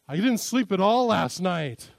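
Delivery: slow, loud mock complaint; exaggerating. Husband exaggerates to his wife, slow and loud. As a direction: overstate it on purpose. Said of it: "accuses me of exaggerating".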